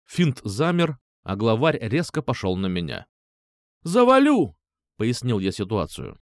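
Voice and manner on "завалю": loud, high furious yell; threatening shout. Acted. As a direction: roar the threat. Threatening the gang leader.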